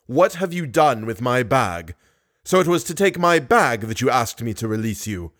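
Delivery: usual speaking voice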